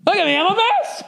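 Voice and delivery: high put-on wail of a wrecked old man; mock self-pity. Future old self wailing about his state, high mock wail. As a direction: whine it like a helpless old wreck.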